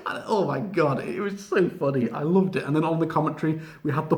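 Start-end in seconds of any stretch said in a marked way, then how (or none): none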